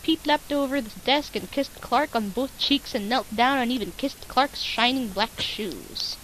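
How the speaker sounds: ordinary talk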